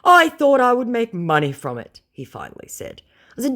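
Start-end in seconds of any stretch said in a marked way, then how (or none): 0.0-2.0 high, loud whiny confession; whiny scammer. Retold or imitated. Scammer admitting his plan in a high whine. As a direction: admit it sheepishly.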